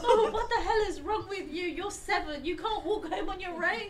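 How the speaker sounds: high, loud mock telling-off with laughter; mock scolding mum. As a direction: berate the kid like an exasperated mum. Mum voiced scolding child.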